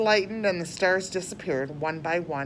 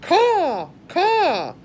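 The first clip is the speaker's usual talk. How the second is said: crow cawing; 11 semitones higher, 7 dB louder than usual. Acted. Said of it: "said the Grandma Crow".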